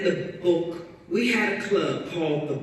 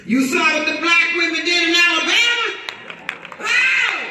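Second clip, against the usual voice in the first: fast, much higher and louder shout; rallying shout; 13 semitones higher, 6 dB louder than usual. Real, not acted. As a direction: fire up the crowd. Rallying crowd to vote.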